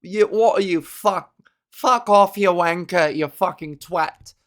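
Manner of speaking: hammed-up British-style swearing; exaggerated British accent, loud then dropping off; mock British-sounding accent. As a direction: put on a cheeky British accent and hurl insults. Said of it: "whenever I hear that accent".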